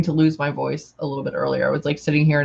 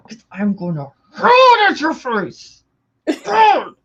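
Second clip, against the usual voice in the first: louder, higher, hammed-up threat; mock rage; 8 semitones higher, 5 dB louder than usual. Friend re-enacts losing it over a Coke, mock raging. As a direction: burst into a comic shout on hurl. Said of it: "like, raging".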